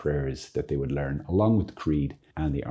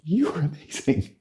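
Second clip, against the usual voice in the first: pitched higher, delighted and admiring; admiring exclamation; 10 semitones higher than usual. Shown as a demonstration. Teacher shows how to greet Mary with eager admiration. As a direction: say it like you're thrilled to see her. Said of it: "So you can go".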